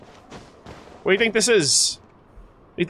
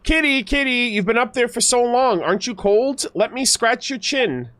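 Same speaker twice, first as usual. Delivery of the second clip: higher, sing-song coo to a kitty; cooing baby talk; 6 semitones higher than usual. Pretend. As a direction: sweet-talk the cat down gently. Cooing to the cat.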